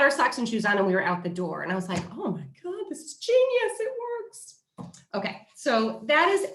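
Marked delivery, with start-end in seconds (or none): 2.1-4.6 high, thrilled exclamation; excited. Retold or imitated. Mom thrilled the new tool worked, high excited squeal. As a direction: squeal it like you've struck gold. Mom's delighted reaction retold.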